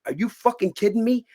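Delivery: high-pitched outraged retort; incredulous anger. Retold or imitated. Acts out his angry outburst.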